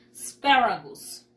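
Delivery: ASPARAGUS as "s-PE-ragus", with E where A is written; E-like vowel. Shown as a demonstration. Teacher pointing out the vowel to children, ASPARAGUS said with an E, "as-PE-ragus". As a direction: stress the E sound in ASPARAGUS, "as-PE-ragus". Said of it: "Como la E aquí".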